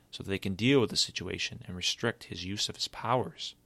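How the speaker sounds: ordinary delivery, nothing marked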